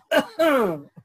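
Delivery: laughing. Real, not acted. Preacher laughs at bold words.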